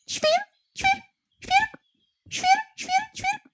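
very high chirped świr, świrk; sparrow chirp. A narrator's reading. Sparrow chirping back at the nightingale, very high. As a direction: tweet it like a sparrow. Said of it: "Na to wróbel zaterlikał".

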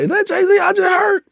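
high, incredulous question; high, stunned is-that-him; starstruck disbelief. Retold or imitated. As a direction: pitch way up, can't believe it. Disbelief hearing [name].

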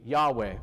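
Yahweh said low, airy, like a breath; breathy name. Shown as a demonstration. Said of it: "sounds like breathing".

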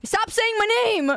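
high-pitched whiny complaint; mock exasperation. Streamer to chat spamming the name, mock exasperation. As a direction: protest like you're being pestered.

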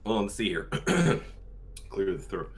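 normal talking voice

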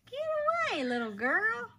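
high squeaky get away; playful high-pitched tease; mock squeal. Teasing toddler, playful squeal.

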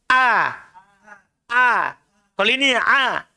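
throat-pressed "A" as ain; contrasting ain "A"; ain vs hamzah. Shown as a demonstration. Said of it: "Jadi dia ndak biasa".

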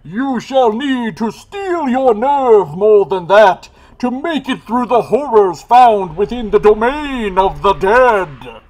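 loud high spectral warning; unearthly voice. Acted. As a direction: boom it like a ghost from the grave. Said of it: "chides a ghostly voice".

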